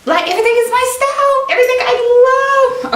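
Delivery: very high, loud laughing shout; real overjoyed outburst; ecstatic squeal. Real, not acted. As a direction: shriek with joy. Next piece thrills her.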